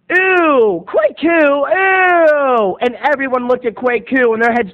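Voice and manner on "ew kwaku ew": high, loud, grossed-out accusing whine; loud schoolkid ew; disgusted ew. Retold or imitated. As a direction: point the finger in mock disgust. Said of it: "and I went".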